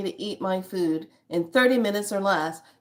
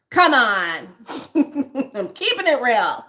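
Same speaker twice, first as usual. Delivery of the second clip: laughing it off, louder and higher; 5 semitones higher, 7 dB louder than usual. Real, not acted. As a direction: giggle through the words. Laughs at own joke.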